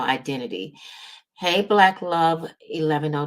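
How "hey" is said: usual speaking voice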